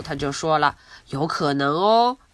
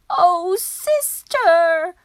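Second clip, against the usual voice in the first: much higher, slow pleading; worried plea; 11 semitones higher, 4 dB louder, much slower than usual. Acted. Younger sister begging her sister to be careful. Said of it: "said Baby".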